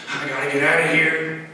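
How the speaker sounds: agitated insistence, building in force; mildly frantic, desperate to leave; restless roommate, escalating. Retold or imitated. Tweaker roommate pacing the detox room, mildly frantic and insistent. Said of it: "walking around the room like".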